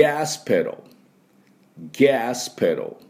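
「ガスペダル」を「ギャーシュペロー」のように英語風に; 「ガス」を「ギャース」と伸ばし「ペダル」は「ペロー」と言う; 英語発音の手本. Shown as a demonstration. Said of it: "これなんていうかっていうとですね". (英語の手本として「ガスペダル」を米国式に「ギャースペロー」)

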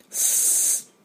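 "ス" as a long, soft hiss without voice; air-leak S sound. Shown as a demonstration. Teacher imitating a leaking tube, long voiceless "ス" hiss. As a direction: let "ス" leak out like air from a tyre. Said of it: "タイヤのチューブで空気が抜ける音".

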